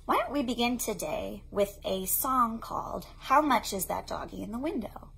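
normal talking voice

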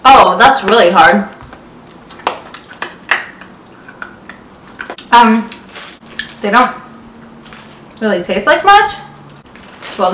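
crunching hard candy while speaking; words around candy in mouth; talking while eating candy. Real, not acted. Seen 1: candy put in mouth at -1, hand over chewing mouth after.

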